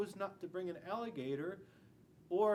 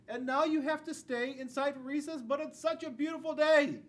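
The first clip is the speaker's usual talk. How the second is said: mock lament; 7 semitones higher, 7 dB louder than usual. Child kept in from recess.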